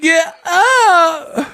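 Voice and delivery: high squealed yell. Retold or imitated. Streamer copying the played clip for laughs, squealing imitation. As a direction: echo the clip in a shrieking falsetto. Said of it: "Why did [name] sound like that".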